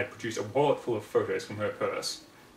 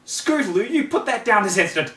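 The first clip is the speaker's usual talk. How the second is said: put-on higher, louder scolding of a child; sharp scolding snap; 9 semitones higher, 8 dB louder than usual. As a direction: snap at the filly to drop it. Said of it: "Rarity snap".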